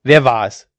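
very loud demand; forceful shouted question; angry call. A narrator's reading. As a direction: confront the whole crowd. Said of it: "rief er".

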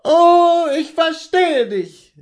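high, loud, drawn-out wail of reproach; anguished cry. Acted. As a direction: cry it slowly at your husband, bitterly. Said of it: "rief sie".

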